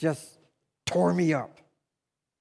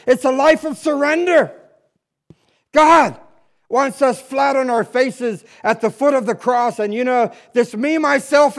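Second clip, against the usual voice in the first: fervent preaching; 5 semitones higher than usual. Acted. Pastor urging the congregation toward surrender, fervently. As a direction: preach it with rising fire.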